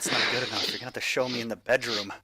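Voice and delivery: low, quiet, menacing threat; a creepy obsessed girl growling low; low menacing yandere. Acted.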